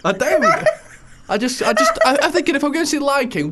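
talking through laughter, pitched high; laughing. Real, not acted. Host defending a dislike of night shows, laughing. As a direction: laugh helplessly while trying to explain yourself.

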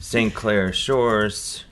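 very slow, savoring each word; drawn out slowly. Real, not acted. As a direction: stretch the name out.

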